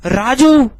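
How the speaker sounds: Raju shouted out high and loud; forceful call. Shown as a demonstration. Teacher calling Raju who stands far off, forceful call. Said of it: "Raju पर मैंने पूरा जोर लगा दिया".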